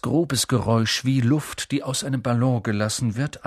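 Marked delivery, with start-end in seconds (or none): none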